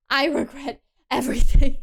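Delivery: loud mock-despairing blurt; loud outburst. Real, not acted. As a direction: throw the line out in mock despair. Sudden outburst over the game.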